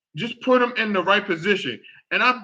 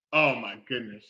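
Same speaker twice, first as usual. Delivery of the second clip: normal talking voice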